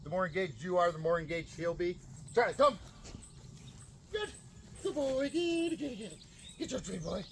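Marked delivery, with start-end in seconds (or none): 2.3-2.8 loud high recall call; calling the dog. Real, not acted. 4.1-7.2 much higher, excited praise; high happy praise. Real, not acted. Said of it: "High and happy tone of voice".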